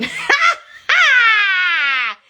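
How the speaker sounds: high, loud burst of laughter that breaks up; big laugh. Real, not acted. Laughing hard at a viewer's joke about meeting Jesus. Reacting to a funny viewer comment.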